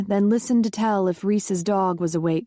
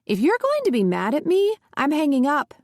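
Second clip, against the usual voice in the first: tense and higher, nearly pleading; pitched-up upset son; 6 semitones higher than usual. A narrator's reading. Threatening his mother he'll hang up, higher and rattled. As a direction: voice jumps higher, rattled and indignant.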